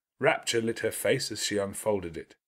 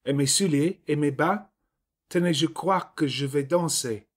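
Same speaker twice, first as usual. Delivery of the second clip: put-on higher, eager little girl showing off; excited French girl; 6 semitones higher, 3 dB louder than usual. A narrator's reading. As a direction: chirp it out, proud and giddy. Child showing off dress.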